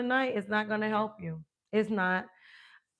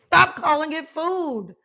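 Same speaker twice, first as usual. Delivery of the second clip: emphatic command; 6 semitones higher, 7 dB louder than usual. Shown as a demonstration. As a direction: stop calling it food, loud, slow and high. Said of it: "I teach people".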